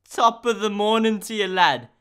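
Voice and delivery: light mock Irish accent; a hint of a stage Irish greeting. Shown as a demonstration. Drafting a message to Dan in a mild mock Irish accent. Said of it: "The Irish accent".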